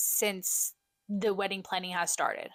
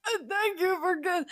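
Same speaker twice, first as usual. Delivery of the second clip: much higher, cracking with tears; choked-up thanks; 11 semitones higher, 3 dB louder than usual. Retold or imitated. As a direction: imitate the crying thank-you to mom. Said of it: "getting all choked up and started saying".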